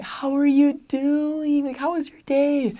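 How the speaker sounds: very high, loud, drawn-out sweetness; gushing, singsong high greeting; kindly high voice. Acted. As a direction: pitch way up to sound extra kind. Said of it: "speak very kindly, my voice goes up".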